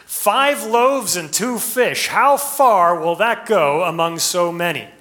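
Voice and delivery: slow, high, loud disciple question; voicing Andrew's worried doubt; a disciple puzzling over the crowd; Andrew asking. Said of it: "Andrew, logically asks".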